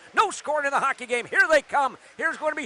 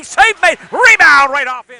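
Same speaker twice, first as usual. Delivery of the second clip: louder on the rebound, punching each word; loud save call; 10 dB louder than usual. Real, not acted. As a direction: call it over the roar.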